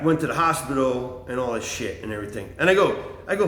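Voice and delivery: ordinary talk, nothing marked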